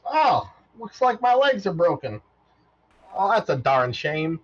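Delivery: high chirpy robot, slowing to mild dismay; a cheerful robot shrugging off broken legs. Acted. Robot to Arya about his broken legs, chirpy and high. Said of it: "and he goes".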